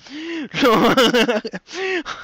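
laughing. Real, not acted. Host laughing at his own joke.

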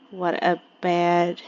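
usual speaking voice

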